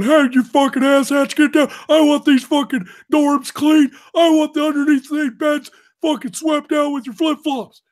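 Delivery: high, loud drill-instructor tirade; Technical Sergeant Ramscock. Retold or imitated. Mimicking the T I ordering the dorm cleaned as a joke, shouted. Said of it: "I'm doing the voice".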